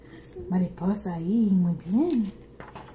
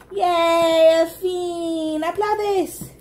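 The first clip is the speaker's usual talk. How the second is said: high, loud happy cheering; excited cheering; 7 semitones higher, 4 dB louder, much slower than usual. Real, not acted. Cheering the toddler at the end.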